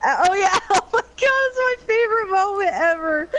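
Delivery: high, loud laughing. Real, not acted. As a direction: giggle through the words. Laughing about Ouch line.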